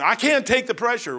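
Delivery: whining about pressure; whiny crybaby. Pretend. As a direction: imitate a complaining churchgoer. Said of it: "you're a whiny crybaby".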